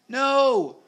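very high, loud cry of protest; screaming. A narrator's reading. Peter leaping in to save Alf, screaming. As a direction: scream it to stop an attack. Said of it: "screamed Peter".

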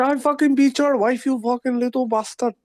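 mock furious shout. Trading insults with a gamer, a hammed-up high yell. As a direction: scream the insult back to win the exchange.

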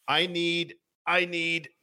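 faint, pleading caller; weak voice. Acted. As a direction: barely get the words out. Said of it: "a weak voice says".

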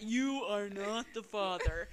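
slow, drawn-out TV host announcement; lightly voiced Maury reveal, spaced out; talk show reveal. A hint of Maury reading the paternity result to the crowd. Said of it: "I used to watch Maury".

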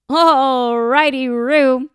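loud, high, dorky singsong; Ross. Retold or imitated. Ross answers Doctor Green's drink order, loud dorky singsong. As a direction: draw it out as a goofy, over-keen reply.

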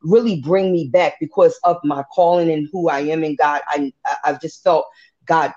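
normal talking voice

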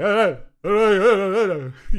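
repeated loud hey calls; calling the boss. Retold or imitated. Clerk shouting for his boss, mock calling. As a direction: call out across the office. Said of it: "He's like".

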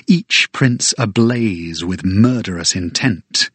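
plain reading voice

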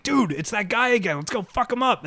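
high-pitched, mock-urgent call to a buddy; excited battle call. Gamer re-enacts spotting an enemy ship, high excited call. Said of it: "and be like".

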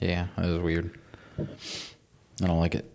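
very slow, quiet deadpan. Real, not acted. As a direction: drag it out flatly.

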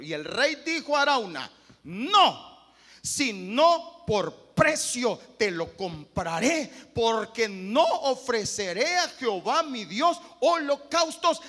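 loud, slow, thundering scripture read; preaching at full force. Acted. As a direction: proclaim David's words. Reading David's answer.

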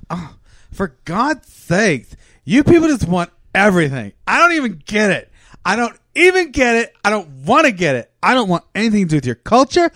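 a put-upon camel guard losing patience, rising to a yelp; exasperated guard rant. Acted. Prisoner pestering the camel guard, rising exasperated rant.

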